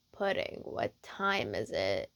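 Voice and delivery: very low, slow, soft sleepy voice; just woken, rumble it drowsily; morning voice. Acted. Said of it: "You never heard his morning voice before".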